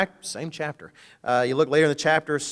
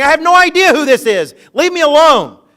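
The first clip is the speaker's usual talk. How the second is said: much higher and louder, furious; cursing denial; 9 semitones higher, 14 dB louder than usual. Retold or imitated. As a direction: rage at the accusers to get rid of them. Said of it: "cussing and calling down curses".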